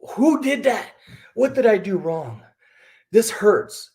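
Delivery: high, wounded little cry of mock dismay; hammed-up hurt feelings. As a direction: play up the sting of that first thumbs down. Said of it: "I was like".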